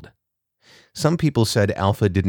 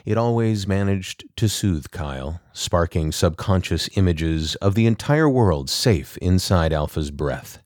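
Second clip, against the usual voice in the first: ordinary delivery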